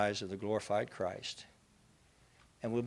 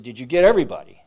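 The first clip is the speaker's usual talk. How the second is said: loud urgent call from the shore; 14 dB louder, faster than usual. Retold or imitated. Townspeople calling out to survivors, loud and urgent. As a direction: shout the question to the survivors.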